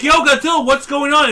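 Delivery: high, loud casual greeting; hammed-up friendly monster; mock monster greeting. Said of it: "And he's like".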